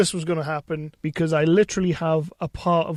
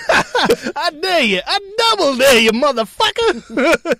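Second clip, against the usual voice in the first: much higher, loud, slow mock Samuel L. Jackson dare; Sam Jack impression; 10 semitones higher, 9 dB louder, slower than usual. Host answering co-host's cue with a loud hammed-up Samuel L. Jackson dare. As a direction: bark the dare with over-the-top Samuel L. Jackson bravado. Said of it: "Say what again?".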